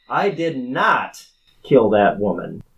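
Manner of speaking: NOT hit hard and drawn out; slow, forceful denial stressing "did not"; emphatic courtroom denial. Pretend. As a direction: swear it from the witness stand, punching NOT. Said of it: "I'm gonna emphasize this".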